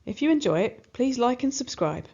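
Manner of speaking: usual speaking voice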